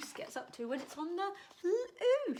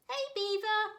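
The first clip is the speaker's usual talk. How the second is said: higher, louder hail across the water; calling out; 11 semitones higher, 6 dB louder than usual. Acted. Gooseberry calling to Beaver in the lake, loud high hail. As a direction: call out to a friend. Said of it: "called Gooseberry".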